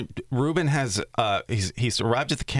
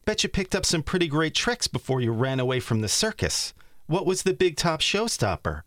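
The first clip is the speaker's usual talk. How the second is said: higher, accusing cartoon bear; Ruben voice; 7 semitones higher than usual. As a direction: build up to a sly accusation. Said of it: "So this is Ruben".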